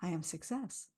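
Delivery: soft, gently lifting at the end; soft affirmation. Shown as a demonstration. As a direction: repeat it softly. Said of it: "I feel it in my body".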